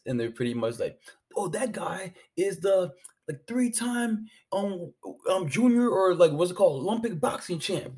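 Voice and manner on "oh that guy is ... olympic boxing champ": awed spectators. Retold or imitated. Spectators recognizing the champ.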